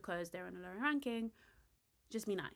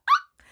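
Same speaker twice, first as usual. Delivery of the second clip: much higher, much louder shriek; reenacted shocked scream; 19 dB louder than usual. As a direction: scream like you just saw something scandalous. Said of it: "did you see my reaction? I literally was like".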